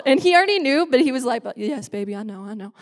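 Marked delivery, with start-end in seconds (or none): 1.6-2.7 soft, hushed reassurance to a lover; tender comforting. Retold or imitated. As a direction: hush it gently, like soothing someone. Boyfriend comforting her, retold.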